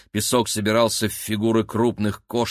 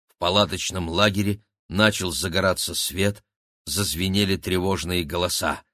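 plain reading voice

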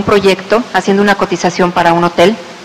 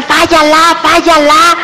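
mock yell; 7 semitones higher, much slower than usual. Golfer retelling what the crowd yelled, mock yell. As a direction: mimic the crowd's misheard chant. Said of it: "que estaban grite y grite".

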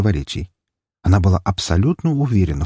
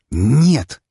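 hissed denial; 5 semitones higher, 4 dB louder than usual. A narrator's reading. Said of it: "сквозь зубы прошипела она".

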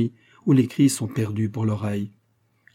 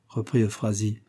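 usual speaking voice

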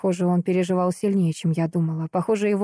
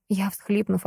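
ordinary delivery

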